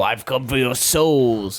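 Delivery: menacing emperor voice; dark lord. Retold or imitated. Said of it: "he's like".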